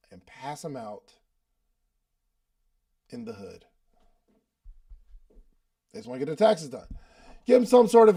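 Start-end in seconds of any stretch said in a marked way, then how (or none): 0.1-3.7 secretive hush. Retold or imitated. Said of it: "this was a secret".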